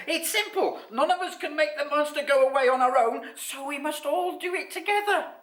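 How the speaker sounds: very high, loud little creature voice; high-pitched, emphatic storybook character; Basil. A narrator's reading. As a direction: squeak out a plan to the group. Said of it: "said Basil".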